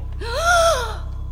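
high, sharp gasp of horror; horrified gasp. Acted. Frankie gasping at the horrifying cavern. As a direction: gasp as if struck by the sight.

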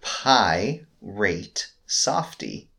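very slow, long gaps between syllables; painstakingly spaced-out puzzle reading; word by word. Shown as a demonstration. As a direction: drag out each chunk separately. Reads puzzle slowly word by word.

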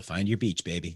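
low, soft ad tagline; imitated beer commercial. Teasing his co-host's happy place, beer commercial voice. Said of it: "like a fucking Corona beer commercial".

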